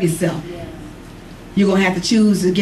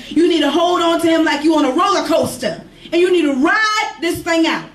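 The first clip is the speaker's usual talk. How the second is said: full-force sermon delivery; preaching at full force; 7 semitones higher than usual. Acted. Preacher builds to a climax.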